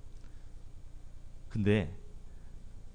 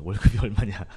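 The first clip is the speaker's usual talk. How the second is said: 월급이 then a laugh, 얼마냐 louder through laughter; laughing repeat; 4 semitones lower, 5 dB louder than usual. Retold or imitated. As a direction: laugh mid-question at 월급이. Repeats uncle's question laughing.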